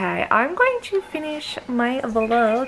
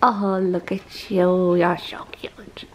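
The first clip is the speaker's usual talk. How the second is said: tender cooing; 6 semitones lower than usual. Real, not acted. Cooing to the puppy.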